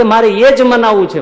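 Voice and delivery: loud, high insistence stressing એ; firm loud demand. Acted. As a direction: put your foot down loudly. Maharaj demanding in quarrel.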